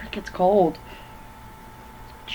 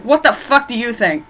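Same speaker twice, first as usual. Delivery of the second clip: louder, higher retold snap at the cop; mock angry yell; 5 semitones higher, 5 dB louder than usual. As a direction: fire it back at the cop, hammed-up outrage. Gibbs yells back at the cop.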